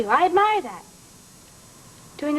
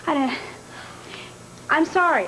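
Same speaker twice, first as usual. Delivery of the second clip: ordinary delivery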